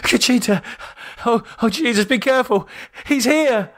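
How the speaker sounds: high, panicked man's cry; frightened warning. A narrator's reading. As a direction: warn her in terror. David frightened, warning her.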